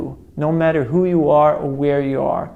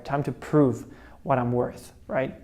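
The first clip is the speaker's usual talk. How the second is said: ordinary talk, nothing marked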